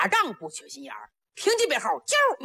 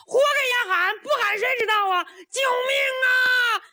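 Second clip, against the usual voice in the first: defiant hollering; 12 semitones higher than usual. A narrator's reading. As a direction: scream for rescue like a stubborn child. Captive defiantly hollering for help.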